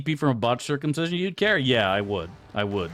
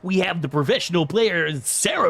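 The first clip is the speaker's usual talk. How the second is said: esports caster voice; 6 semitones higher than usual. Pretend. Streamer mocks a League commentator for chat, hyped caster read. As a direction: sell it like an excited match announcer. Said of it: "Would you guys watch D to the Aveorn Deveorn?".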